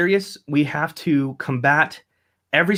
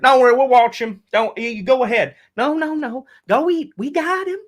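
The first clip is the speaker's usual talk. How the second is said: much higher, rising mock-reassuring drunk guy; beer-holding party guy, pitched way up, climbing higher; Busch Light guy; 11 semitones higher than usual. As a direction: ham up the beer guy promising to watch the baby. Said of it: "a guy with a Busch Light".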